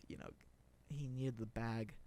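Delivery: normal talking voice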